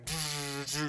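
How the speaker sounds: buzzing synthesizer noise; vocal imitation of big synth chords; imitated synth. Showing the huge synthesizer chords, buzzed mouth noise. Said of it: "it's, like, huge".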